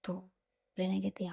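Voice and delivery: plain reading voice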